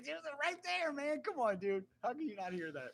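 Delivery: high, loud retold disbelief; exasperated protest. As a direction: plead your case to a disbelieving buddy. Retells protesting to his buddy.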